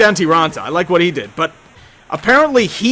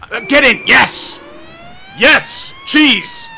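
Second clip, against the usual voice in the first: excited cheering; 8 semitones higher, much slower than usual. Real, not acted. Goal celebration while watching game.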